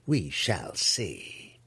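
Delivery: low, confident purr. Acted. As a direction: let it sink into a drawn-out purr. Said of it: "Malice purred".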